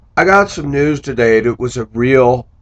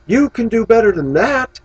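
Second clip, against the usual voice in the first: much higher, exasperated quote; frustrated plea; 13 semitones higher, 3 dB louder than usual. Retold or imitated. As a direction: plead with her in disbelief. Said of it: "I was frustrated".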